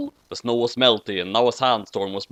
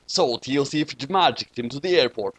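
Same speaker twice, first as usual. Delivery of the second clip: plain reading voice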